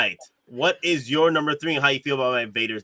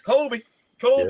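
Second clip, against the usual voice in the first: put-on higher, playful shot-calling cry of a kid; kid calling out Kobe; 11 semitones higher than usual. Host recalling high school, calling out Kobe. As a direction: call it out like tossing a shot as a kid. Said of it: "I'd be like".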